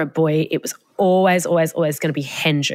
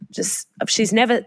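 ordinary talk, nothing marked

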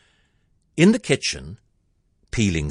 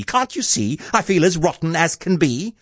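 high-pitched, complaining Cindy; exasperated high cry; 9 semitones higher than usual. A narrator's reading. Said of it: "cried Cindy".

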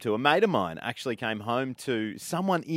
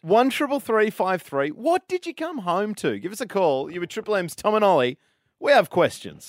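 radio call-out; 6 semitones higher, 3 dB louder than usual. Acted. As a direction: invite listeners to ring in, presenter style.

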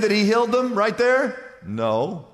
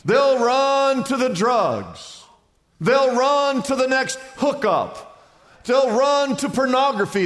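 preaching at full force; 4 semitones higher than usual. Acted. Pastor preaching hard to the congregation, emphatic and rising. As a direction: hammer each line like a pulpit refrain.

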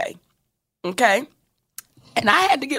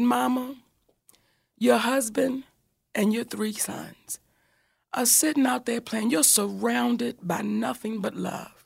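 soft, measured husband soothing his wife; hushed, even, reassuring voice; calm; 7 dB quieter than usual. Retold or imitated. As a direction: imitate a patient partner talking someone down gently. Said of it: "this is just how calm he's talking to me".

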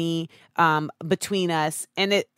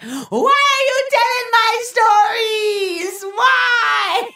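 high, slow sing-song wail into a shout; wailing mom; 5 dB louder, much slower than usual. Pretend. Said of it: "is somewhere right now like".

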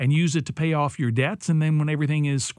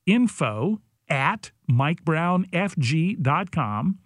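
spelling it out slowly; each part deliberate; slow and clear; 2 semitones higher, much slower than usual. Shown as a demonstration. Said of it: "spells out email slowly".

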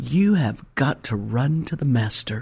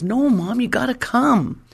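put-on insistent child; 10 semitones higher than usual. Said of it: "And it goes".

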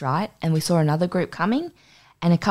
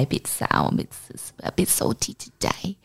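trailing off into a whisper; mumbled correction; 5 dB quieter than usual. Retold or imitated. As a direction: whisper the words away as if embarrassed. Mumbled euphemisms to crying stranger.

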